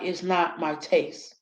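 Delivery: soft polite phrase. Shown as a demonstration. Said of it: "I use words like".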